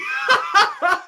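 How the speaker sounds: high, disbelieving burst of laughter; real laugh. Real, not acted. Fan laughing at his team's tough group, disbelieving laugh. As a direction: laugh out loud at the cruel draw.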